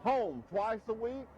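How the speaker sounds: steady, pitchy live ad read for a trucking company; host reading a truck line ad on air; radio ad read. Acted. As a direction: sell it like a local radio spot.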